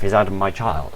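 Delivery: ordinary delivery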